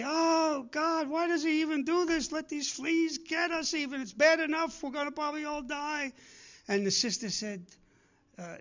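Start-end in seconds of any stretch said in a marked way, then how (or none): 0.0-6.1 high, tearful whine about the fleas; a near-crying lament, pitched up high; Corrie complaining. Retold or imitated. Corrie cries to her sister in the camp, tearful high whine. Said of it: "And Corrie would say".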